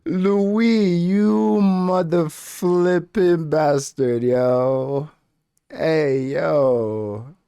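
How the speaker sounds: loud, high, drawn-out hype at the screen; real excited shouting at the screen; hyped yelling. Real, not acted. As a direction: cheer the character on like a fight fan. Hyped reaction to Louis snapping.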